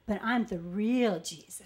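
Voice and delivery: low, slow, solemn mock Jesus; lightly voiced defensive preacher insisting; defensive fake Jesus. Old coworker claiming to be Jesus, mock solemn. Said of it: "he got defensive, and he goes".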